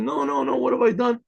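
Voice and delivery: high-pitched, anguished mock protest; distraught lament. Rabbi to his family after falling down, distraught.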